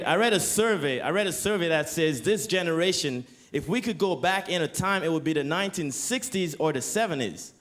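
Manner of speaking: normal talking voice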